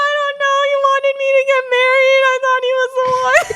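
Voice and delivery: high, fast whiny excuse; whiny mock excuse. Daughter whining her excuse to her mom. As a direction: whine it like a sulking kid. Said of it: "I was like".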